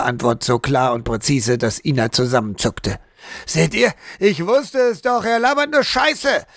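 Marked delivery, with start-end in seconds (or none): 3.5-6.4 much higher, rowdy jeering shout; bawling. A narrator's reading. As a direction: crow triumphantly over the room. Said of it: "krakeelte Franz Klemm".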